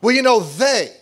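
loud whiny mock complaint; whiny complainer. Preacher mocking a gossiping parent, loud whiny tone. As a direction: mimic a parent blaming others. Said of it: "they use the word they".

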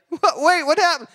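high, startled mock panic; a left-behind pastor suddenly realising; confused shock.

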